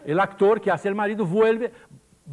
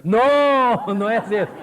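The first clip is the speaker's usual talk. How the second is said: un "No" alto y fuerte; grito colectivo; 6 dB louder, much slower than usual. Retold or imitated. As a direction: gritar que no, indignadas. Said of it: "se levantaron y dijeron". (no, gritado)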